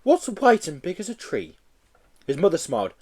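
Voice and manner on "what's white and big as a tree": put-on high childlike call to mummy; little boy voice. Small boy calling to his mummy, high childlike voice. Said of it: "asked the little boy".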